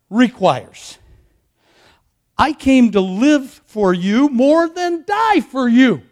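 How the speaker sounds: slow, weighty preacher's cadence; emphatic preaching. Real, not acted. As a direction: stretch the key words and pause between them.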